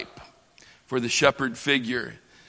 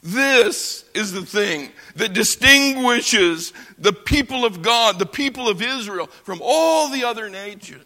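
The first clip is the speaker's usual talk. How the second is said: normal talking voice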